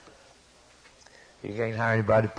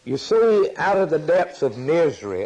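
loud, high preacher's cry; preaching shout; 6 semitones higher, 4 dB louder than usual. Real, not acted. Preacher shouting to the congregation about sorrow, loud and high. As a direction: belt it out to the congregation.

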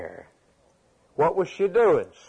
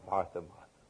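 low and much softer, tender; lovingly; 7 semitones lower, 11 dB quieter than usual. Shown as a demonstration. Jesus tenderly answering busy Martha, soft and low. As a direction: breathe the name twice with fond care. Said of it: "That's a loving comment".

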